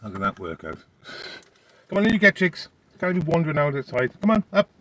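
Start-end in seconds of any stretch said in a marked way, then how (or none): 1.9-4.7 loud, high call to the dog; raised pet-calling voice. Real, not acted. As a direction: summon the dog in firmly but brightly.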